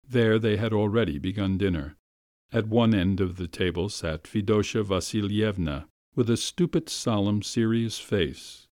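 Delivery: plain reading voice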